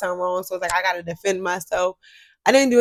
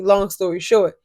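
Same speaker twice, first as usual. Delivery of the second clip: ordinary talk, nothing marked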